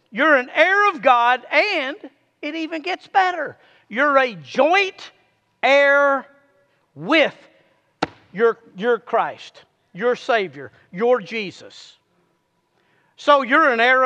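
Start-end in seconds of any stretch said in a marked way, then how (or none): none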